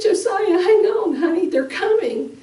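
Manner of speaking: high, pleading reassurance; high frantic mother comforting her son. Retold or imitated. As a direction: hold him together through panic. Mother calming dying son.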